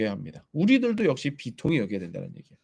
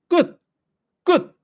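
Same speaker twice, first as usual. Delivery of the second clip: emphatic declaration; 12 semitones higher, 5 dB louder, much slower than usual. Real, not acted. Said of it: "emphatic ending".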